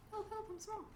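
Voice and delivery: mock tiny voice. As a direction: play a tiny helpless person crying for help. Shrinking her video image.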